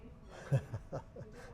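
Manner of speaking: soft, breathy chuckle; laugh. Real, not acted. Dialect speaker amused by interviewer's question, soft laugh.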